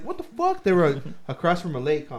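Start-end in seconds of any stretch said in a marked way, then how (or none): 0.0-0.6 high squeaky mock dog voice. Voicing a dog in a video.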